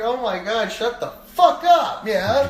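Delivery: high, loud fed-up outburst; exasperated yell. Retold or imitated. Older cousin to crying kid on the ride, exasperated yell.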